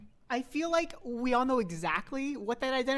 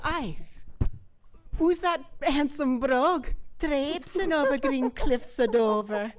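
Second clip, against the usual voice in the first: hammed-up Irish accent, raised and louder; cartoonish Irish accent, slower; Irish brogue; 5 semitones higher, 4 dB louder, slower than usual. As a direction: play a mock Irish lad with a lilting brogue. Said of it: "very redheaded Irish boy".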